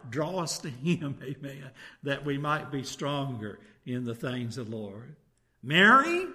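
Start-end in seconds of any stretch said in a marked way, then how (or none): 5.6-6.3 high, loud call of "Mary"; raised, ringing summons. Acted. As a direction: call to a sister across the house. Voices Martha calling her sister.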